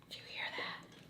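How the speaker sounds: barely audible whispered question; secretive whisper. Retold or imitated. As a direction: whisper it so only your friend hears. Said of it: "Like that".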